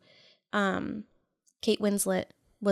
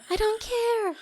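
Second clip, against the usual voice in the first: mock swooning girl; 13 semitones higher, slower than usual. Mock Bella reply.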